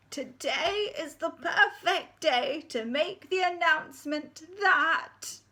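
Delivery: put-on high, slow, quavering old lady voice; creaky old headmistress announcing; ancient head teacher. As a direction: speak as a wobbly ninety-nine-year-old.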